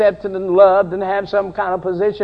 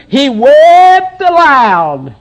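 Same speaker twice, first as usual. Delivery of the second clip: slow, louder, dwelling on wept; drawn-out emphasis; 6 semitones higher, 6 dB louder, much slower than usual. Shown as a demonstration. Preacher reading Scripture to the church, slowly stressing wept. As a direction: stretch out wept for weight. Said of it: "And in verse two".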